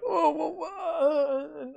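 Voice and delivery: high slow baffled wha-; flustered stammer. Retold or imitated. Startled drinkers being shoved, high slow stammer. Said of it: "they're like".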